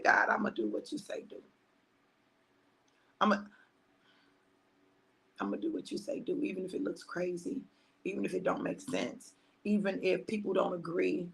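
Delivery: soft, partly whispered prayer; quiet surrender. Retold or imitated. Telling God she will obey, soft surrendered prayer.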